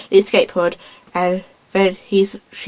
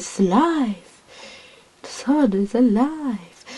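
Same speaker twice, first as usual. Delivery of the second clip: awed dread; 4 semitones higher, 4 dB quieter, slower than usual. Retold or imitated. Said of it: "he goes".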